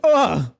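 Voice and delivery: sharp grunt of pain. Acted. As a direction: grunt as if struck by an axe. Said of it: "He's like".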